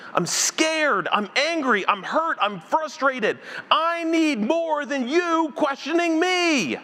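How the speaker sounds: high-pitched, exasperated acted rant; losing his mind. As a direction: plead your case to God, at wit's end. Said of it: "I'd probably lose my mind".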